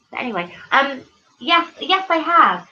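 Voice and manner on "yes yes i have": normal talking voice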